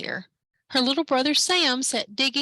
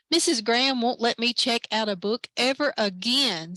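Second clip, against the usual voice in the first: ordinary delivery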